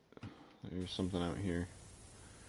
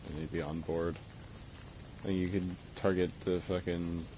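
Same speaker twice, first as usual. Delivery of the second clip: ordinary talk, nothing marked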